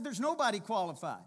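preacher's emphatic repeat. Real, not acted. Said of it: "I said".

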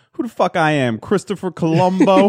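loud, slow, high tough guy; tough street guy. Pretend. Imagined gruff local.

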